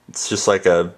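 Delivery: ordinary talk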